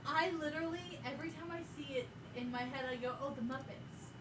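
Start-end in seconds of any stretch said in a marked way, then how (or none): none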